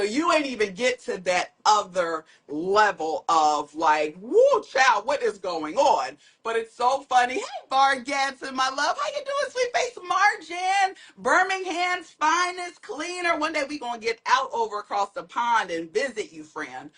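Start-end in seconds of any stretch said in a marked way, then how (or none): 4.2-6.2 mock astonishment. Acted astonished reaction to baby's lungs. 7.3-13.4 playful shout-out. Real, not acted. Calling out greeting to a friend.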